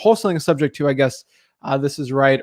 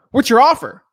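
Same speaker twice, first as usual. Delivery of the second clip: pushy seller demanding the offer, higher and louder; attacking seller; 8 semitones higher, 5 dB louder than usual. Retold or imitated. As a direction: fire the question at him impatiently. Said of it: "just attacking me on what my offer is".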